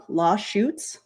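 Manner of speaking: "lawsuits" slipping into "law-shoots"; slurred slip. Real, not acted. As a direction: stumble into a SH sound in "lawsuits". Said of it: "that's a new one".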